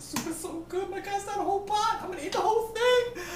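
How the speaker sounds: high, squealing excitement over the food; high gleeful squeal about eating it all; excited squeal. Real, not acted. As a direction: squeal with greedy delight.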